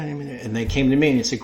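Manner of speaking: normal talking voice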